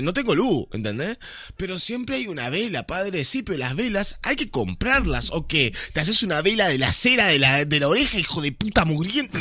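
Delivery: real exasperated ranting; angry rant. Real, not acted. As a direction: tear into them, voice rising. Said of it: "me doy cuenta que estoy gritando un montón".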